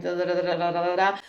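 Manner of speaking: "da, da, da" droned on one even note; monotone. Shown as a demonstration. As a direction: keep every "da" level, no up and down. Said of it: "German is more like this zero line".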